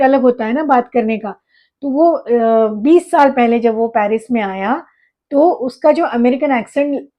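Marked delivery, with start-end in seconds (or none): none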